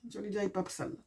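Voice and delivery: ordinary talk, nothing marked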